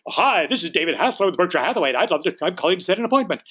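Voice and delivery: high, rushed sales greeting; over-eager mismatch. Acted. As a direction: race brightly through the pitch. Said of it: "then you better not say".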